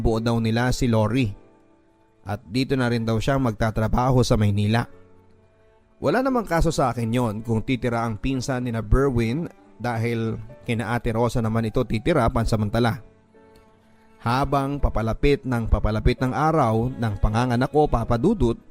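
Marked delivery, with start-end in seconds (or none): none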